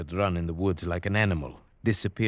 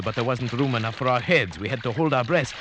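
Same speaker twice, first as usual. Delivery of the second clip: ordinary delivery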